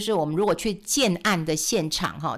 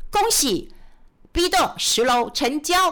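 announcing; 7 semitones higher, slower than usual. Retold or imitated. Sales staff announcing a sale.

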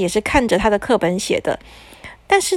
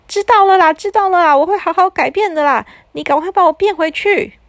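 put-on high pleading kid voice; whiny child; 8 semitones higher than usual. A narrator's reading. Scolded boy begs the wizard, high whiny child voice.

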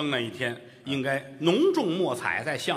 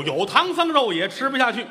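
sulky mother; 10 semitones higher, 5 dB louder than usual. Acted. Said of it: "对不起".

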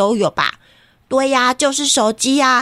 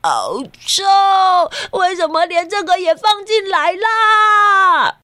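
disgusted shout; 8 semitones higher than usual. Acted. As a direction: yell in disgust. Child disgusted at smelly bag.

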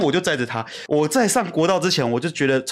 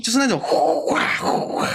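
mock wind whoosh; 7 semitones higher than usual. Imitating storm wind.

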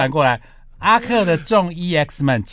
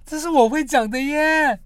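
surprised admission; 13 semitones higher than usual. Real, not acted.

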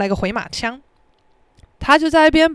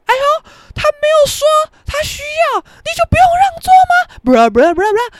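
high-pitched, loud mock old-man complaint; nagging old man; 5 dB louder than usual. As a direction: imitate a whining uncle in a raised, squawky pitch. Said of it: "前面的阿伯就说". (夸张模仿阿伯高声碎念)

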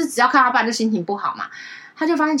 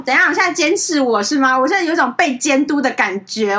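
higher, louder mock sneer; sneering retort; 7 semitones higher, 7 dB louder than usual. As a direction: imitate a cold scoff at being watched. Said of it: "他就冷笑".

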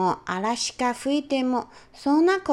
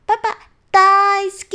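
much higher, much louder happy cry; joyful shout; 9 semitones higher, 9 dB louder than usual. Acted. Daughter hugging dad and shouting she loves him, loud and happy. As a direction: shout your love out loud. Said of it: "と叫びました".

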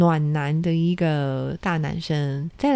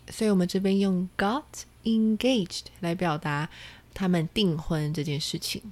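usual speaking voice

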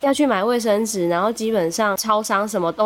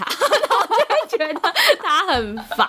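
normal talking voice

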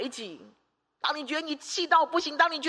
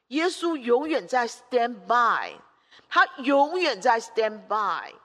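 slow emphatic preaching; much slower than usual. Real, not acted. Stresses Jesus always on standby.

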